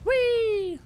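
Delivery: very high sustained squeal; playful whee. Real, not acted. Player flying through the level, playful squeal. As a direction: sail up into a long high "whee".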